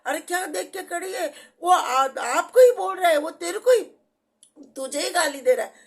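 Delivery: imitating neighbours telling her she's the one being abused; neighbours urging her. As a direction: retell the neighbours calling out to her, worked up. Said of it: "उधर के लोग बोलने लगे".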